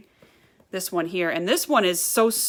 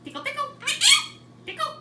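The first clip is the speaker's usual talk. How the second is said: talking to a bird; 8 dB quieter than usual. Real, not acted. High-pitched talk to pet birds.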